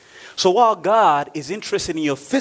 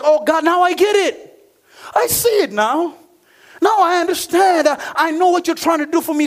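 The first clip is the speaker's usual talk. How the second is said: high, raised cry of understanding to God; dawning realization; 11 semitones higher, 4 dB louder than usual. Acted. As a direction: preach it as a sudden revelation. Acting believer's sudden understanding in prayer.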